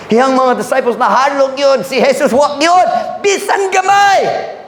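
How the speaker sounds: high, slow, rising preacher's shout; preaching at full force. Acted. Pastor telling the church Jesus feared nothing, preaching at full force. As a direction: build to a ringing cry.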